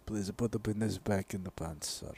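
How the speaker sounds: low mock Russian accent. Russian guys telling him to put it away, in a Russian accent. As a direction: order him about as a deadpan Russian. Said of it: "Russian guys telling another Russian guy".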